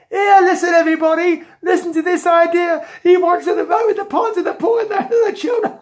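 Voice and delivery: high, loud jeering call to a crowd; mocking shout. Policeman telling everybody about Jack's idea, jeering. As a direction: holler it out in hammed-up ridicule.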